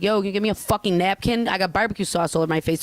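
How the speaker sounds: fast, punchy demand around the curse word; street-tough ask for a napkin, fast; cursing demand. Shown as a demonstration. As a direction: say it rough and quick so they jump. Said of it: "It's an inflection".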